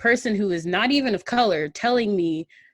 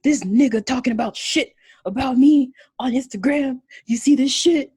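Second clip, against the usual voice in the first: retold angry brother; 6 semitones higher, 4 dB louder than usual. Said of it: "his brother busts in the door".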